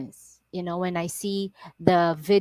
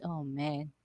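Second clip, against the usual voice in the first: ordinary delivery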